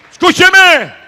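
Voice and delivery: loud, high call for attention; commanding shout. Real, not acted. Preacher demanding congregation listen, commanding shout.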